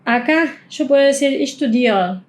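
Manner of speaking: normal talking voice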